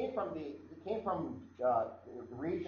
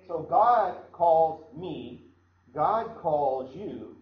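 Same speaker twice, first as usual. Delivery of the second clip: slow, loud stress on each word of "God calls me, God calls you"; "God calls me, God calls you" in slow, weighty beats; emphatic preaching; 2 semitones higher, 8 dB louder, much slower than usual. Real, not acted. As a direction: drive home "God calls me, God calls you" slowly and loudly. Preacher drives home the point.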